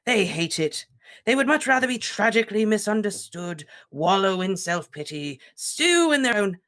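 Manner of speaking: sneering, sly aside. Acted. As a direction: needle the headmaster slyly. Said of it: "said Phineas Nigellus slyly".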